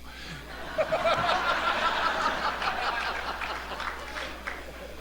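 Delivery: breathy high-pitched real laugh; soft high laugh. Real, not acted. Laughing at the fan's broken-back line, soft and high. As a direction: laugh quietly at the punchline. Laughs at his own story.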